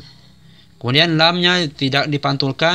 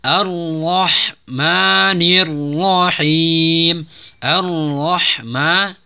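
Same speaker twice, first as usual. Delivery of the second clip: very slow melodic recitation of Bismillahirrahmanirrahim; Quran recitation; 3 semitones higher, much slower than usual. Shown as a demonstration. Teacher models full recitation, slow melodic chant. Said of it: "Ayat berikutnya".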